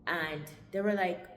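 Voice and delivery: normal talking voice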